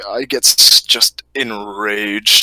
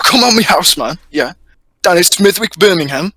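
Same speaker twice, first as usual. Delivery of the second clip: put-on Birmingham accent; 6 semitones higher than usual. Birmingham bloke caricature.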